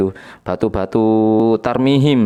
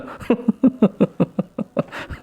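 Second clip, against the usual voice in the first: high laugh; 11 semitones higher, 3 dB quieter than usual. Real, not acted. Laughing at own mockery.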